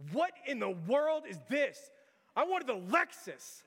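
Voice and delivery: loud-ish, slow, high bratty outburst; mock spoiled tantrum. Spoiled girl to Dad over her birthday car, mock tantrum. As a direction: throw a hammed-up fit, drawing out each word. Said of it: "pitching an absolute fit".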